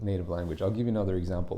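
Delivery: normal talking voice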